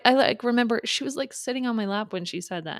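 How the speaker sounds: ordinary delivery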